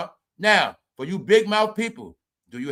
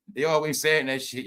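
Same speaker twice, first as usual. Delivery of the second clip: normal talking voice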